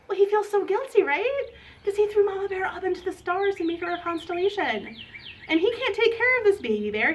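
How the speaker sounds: high, concerned story voice; animated, high-pitched narration; children's storytelling. A narrator's reading. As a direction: tell kids of the trouble with exaggerated worry.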